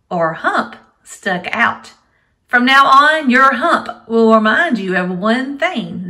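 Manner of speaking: loud, lively storybook reading; reading to a toddler. Acted. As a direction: read aloud to small children. Teacher reads story to kids.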